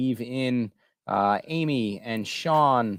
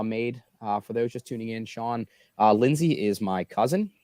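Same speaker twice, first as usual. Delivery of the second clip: ordinary talk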